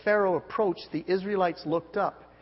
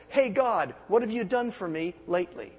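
demanding complaint; 4 semitones higher, 5 dB louder than usual. Retold or imitated. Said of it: "a little phrase ... It goes like this".

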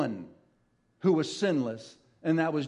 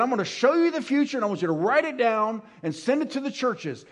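much higher and louder, rushing with wonder; thrilled pulpit voice, racing ahead; excited preaching; 7 semitones higher, 3 dB louder than usual. Acted. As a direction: build the excitement as if revealing a secret. Excited preaching about heaven's books.

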